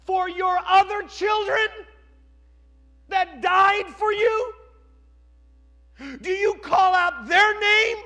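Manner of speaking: high, loud, slow shouting reproach; angry rebuke. Acted. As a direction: tear into the king for his weeping. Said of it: "and says".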